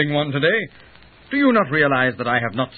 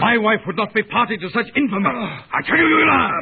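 angry shout; 7 semitones higher than usual. Acted. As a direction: yell it, building to rage. Husband denies wife's infidelity.

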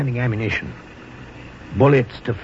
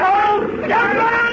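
urgent shout; much slower than usual. Acted. Urgent orders during charge.

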